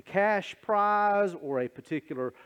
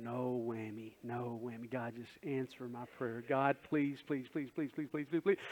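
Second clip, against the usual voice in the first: soft low murmured no whammy pleading; quiet no whammy prayer; 5 semitones lower, 12 dB quieter than usual. Retold or imitated. Worshipper quietly pleading with God in prayer, soft and low. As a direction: mutter the plea under your breath. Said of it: "the whole time you're going".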